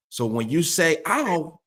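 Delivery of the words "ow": high-pitched ow of mock hurt; not normal tone. Shown as a demonstration. Trainer showing owner the puppy correction, hurt tone. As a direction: pitch it up, out of your everyday voice. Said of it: "that's not your normal tone".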